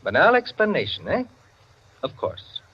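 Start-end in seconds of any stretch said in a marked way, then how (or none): 2.0-2.6 muttered aside. Acted. Narrator's dry aside mid-story.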